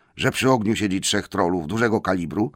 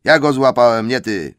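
troll claim; 3 semitones higher, 8 dB louder than usual. Acted. William claims catch.

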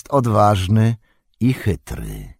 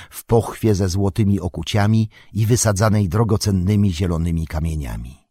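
usual speaking voice

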